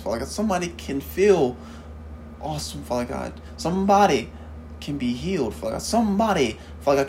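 fervent spoken prayer, rising and pleading. Acted. Preacher praying aloud over the congregation, fervent and pleading. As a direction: pray it aloud, calling on the Father. Opening prayer before the sermon.

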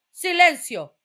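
a hint of a stern chief; stern command. A narrator's reading. Police chief silencing everyone. (el jefe manda callar, fuerte)

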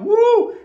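high, loud "uh!" cheer; excited whoop. Real, not acted. Host greets a viewer from far away, excited whoop. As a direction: whoop loudly in celebration.

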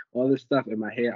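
ordinary delivery

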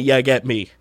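lightly put-on Cockney London podcast host; playful mock Cockney London intro; Cockney London accent. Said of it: "my British accent's a bit good there, bruv".

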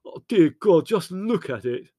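high, loud, anguished moan; drawn-out wail of dismay. A narrator's reading. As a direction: lament a ruined treasure. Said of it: "moaned Leslie".

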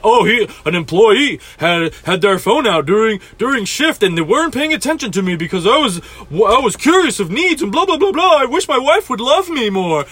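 whiny complaining customer voice, lightly put on; mock the customer's Yelp whine. Server mocking a customer's Yelp complaint, whiny mock voice. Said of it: "go on Yelp and complain that".

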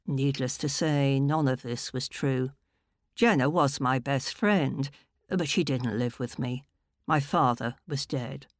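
ordinary delivery

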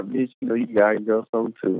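ordinary talk, nothing marked

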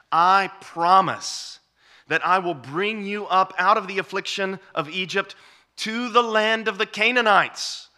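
booming preacher voicing the Lord; preaching proclamation. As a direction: ring out God's promise to the hall. Proclaims God's promise.

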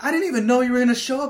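fast, high, loud protest; mock yelling. Naked friend to surprise visitor, flustered yelling. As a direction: rattle it off in flustered outrage. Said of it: "while you're both yelling".

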